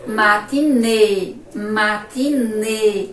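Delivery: Matinê said slowly, stretched, closed ê at the end; slow teaching demo. Shown as a demonstration. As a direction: drag out Matinê, landing on the closed final ê. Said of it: "Vamos agora à palavrinha matinê".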